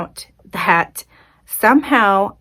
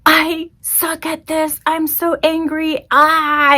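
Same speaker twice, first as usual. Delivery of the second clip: higher, slow, wound-up self-directed rant; angry outburst; 8 semitones higher, slower than usual. Retold or imitated. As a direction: spit the frustration out, voice climbing. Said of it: "word vomiting in an angry tone".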